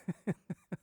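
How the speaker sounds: soft real chuckle; quiet, helpless giggle; laughing. Real, not acted. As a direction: break off to laugh at your own story. Cracks up describing the commotion.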